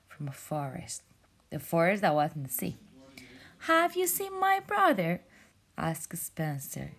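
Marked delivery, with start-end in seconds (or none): 3.7-5.2 high, hopeful question; high jellyfish asking. Acted. Jellyfish asks mermaid about lost brother, high hopeful. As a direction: ask it in a small high voice.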